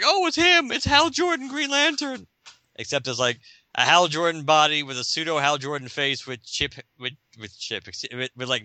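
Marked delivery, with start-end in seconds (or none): 0.0-2.2 very high, squealing delight; mock excited relief. Relieved bankers spot who they think is Hal Jordan, squealing high delight. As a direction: gasp it like a starstruck fan. Bankers see hero arrive.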